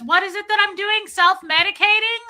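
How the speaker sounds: imitating mom yelling high and loud; tipsy yelling. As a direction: yell the question across the aisle. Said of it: "And she yells it".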